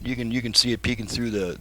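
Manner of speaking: ordinary talk, nothing marked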